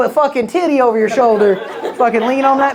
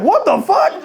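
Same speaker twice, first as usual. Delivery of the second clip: high, startled "What the fuck?"; laughing high mock outrage; appalled onlooker; 10 semitones higher than usual. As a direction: leap up high in mock shock. Audience reaction acted out.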